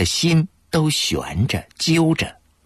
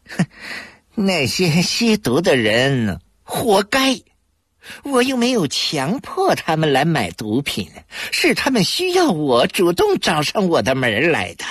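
vicious; 9 semitones higher than usual. A narrator's reading. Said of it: "他恶毒地说".

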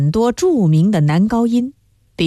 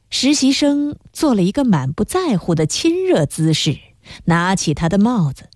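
ordinary delivery

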